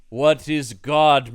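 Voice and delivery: drawn-out naive question; slow puzzled monster. Retold or imitated. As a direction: ask it slowly like a confused creature.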